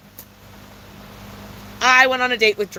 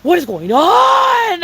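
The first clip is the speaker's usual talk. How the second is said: exasperated shout; 5 semitones higher, 4 dB louder, slower than usual. Real, not acted. Exasperated outburst at a date.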